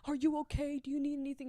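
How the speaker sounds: high, sharp rebuke turning to concern; furious then caring. Retold or imitated. Girl yelling at Pan, then asking her, high and urgent. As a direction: snap at him, then check on her. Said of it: "She's like".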